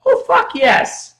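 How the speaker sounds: thrilled to hear the name; excited yes. Real, not acted. Host cheers on hearing a favorite artist's name.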